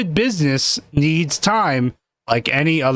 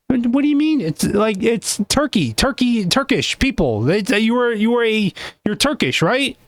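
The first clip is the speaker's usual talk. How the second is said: a touch higher, incredulous questioning host; lightly voiced high interviewer; 8 semitones higher than usual. Interviewer quizzing the guest about being Turkish, lightly raised voice. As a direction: play the puzzled host prodding the guest.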